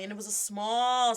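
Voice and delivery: plain reading voice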